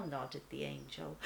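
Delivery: ordinary delivery, nothing marked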